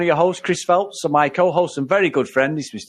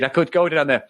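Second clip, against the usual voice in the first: normal talking voice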